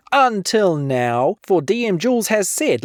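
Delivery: ordinary delivery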